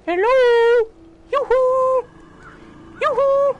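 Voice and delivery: high singsong call; lilting falsetto yoo-hoo; sing song yoo hoo. Acted. Calling zombies over.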